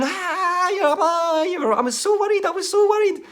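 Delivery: very high tearful mock wail; mum screaming. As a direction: sob out the worry in a high wail. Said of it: "me screaming".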